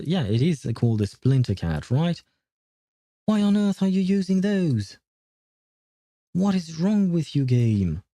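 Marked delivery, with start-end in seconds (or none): 3.2-8.0 exasperated. Real, not acted. Streamer scolding game's auto assign.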